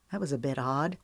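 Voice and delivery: ordinary delivery, nothing marked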